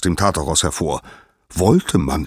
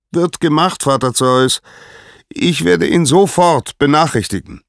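very high, loud dutiful reply; high, ringing herald's voice; Helios calling down; 12 semitones higher, 6 dB louder than usual. A narrator's reading. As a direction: acknowledge the order from high above. Helios answers Zeus from sky.

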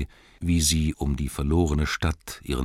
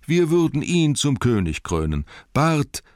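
higher and louder, hailing a hero; eager crowd acclaim; 11 semitones higher, 6 dB louder than usual. Acted. Townsfolk on the shore hail Bard as king, eager and loud. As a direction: lift it up to crown him. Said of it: "sagten sie alle".

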